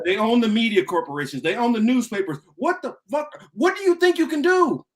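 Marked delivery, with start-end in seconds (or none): 2.6-4.9 much higher, disbelieving shout; incredulous outburst. Real, not acted. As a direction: throw the question at them in disbelief. Incredulous rant.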